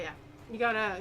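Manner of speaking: ordinary delivery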